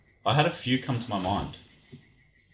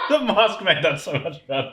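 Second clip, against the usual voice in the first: real laughter, high-pitched, through the words; laughing; 11 semitones higher, 6 dB louder than usual. Real, not acted. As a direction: crack up while you speak. Cracks up at the masked reveal.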